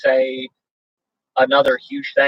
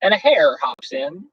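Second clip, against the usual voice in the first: lively, sing-song read-aloud of a rhyming book; storybook reading; 6 semitones higher, slower than usual. A narrator's reading. Host reading a bedtime story to viewers, sing-song.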